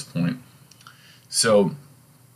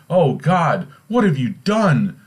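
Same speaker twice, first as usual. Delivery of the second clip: high-pitched horrified outcry, half scream; mock scream; 8 semitones higher, 4 dB louder than usual. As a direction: cry out in acted horror. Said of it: "Gertrude screamed".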